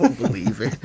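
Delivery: words broken up by a high laugh. Retold or imitated. Mocking himself for being like Jez, laughing. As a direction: laugh through the disbelief. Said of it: "just think".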